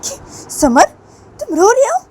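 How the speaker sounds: high, loud disbelief; startled question. A narrator's reading. Teacher discovers the student crying, loudly startled. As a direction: jump up in pitch, alarmed at the tears.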